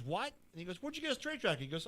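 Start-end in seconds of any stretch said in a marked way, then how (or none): 0.0-0.3 high, incredulous yelp; shocked what. Retold or imitated. Manager hears about straitjacket. 0.8-1.6 much higher, disbelieving question; incredulous. Retold or imitated. Manager hears about straitjacket.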